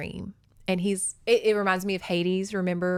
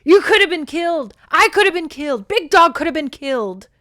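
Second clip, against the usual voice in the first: high, loud, hammered accusations; enraged shouting; 9 semitones higher, 11 dB louder than usual. A narrator's reading. Derek rages that they could have died, shouting. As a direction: shout each line as an outburst. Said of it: "sudden turn from tenderness to rage".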